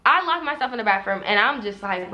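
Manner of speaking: normal talking voice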